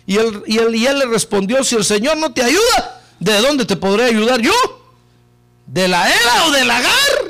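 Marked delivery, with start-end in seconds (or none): 1.6-7.3 a touch of scorn, rising higher; lightly voiced taunt; mocking king. King mocking the starving woman, lightly scornful. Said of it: "le dijo burlándose el rey".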